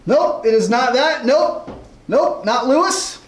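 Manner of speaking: normal talking voice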